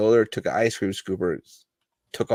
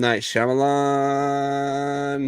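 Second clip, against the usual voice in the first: drawn-out slow clue names; hinting slowly to a friend; slow teasing clues; 3 semitones higher, much slower than usual. Real, not acted. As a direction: draw out each clue to tease.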